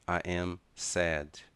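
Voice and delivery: slow "I am sad", long vowel in "sad"; stretched vowel in "sad"; drawn-out sad. Shown as a demonstration. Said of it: "a pronúncia é mais prolongada".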